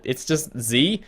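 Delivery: usual speaking voice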